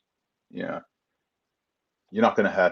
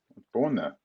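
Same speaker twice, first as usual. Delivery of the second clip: quieter, lower repeat; 7 dB quieter, faster than usual. Shown as a demonstration.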